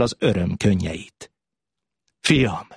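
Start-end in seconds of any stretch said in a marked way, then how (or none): 2.2-2.7 blurted out. A narrator's reading. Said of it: "puddyant fel torkából a szó".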